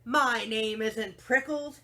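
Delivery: loud, slow indignant yell; yelled back. Acted. Hank yells back at the jackrabbit, loud and slow. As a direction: snap back at the teasing. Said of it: "Hank yelled back".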